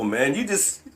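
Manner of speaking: ordinary talk, nothing marked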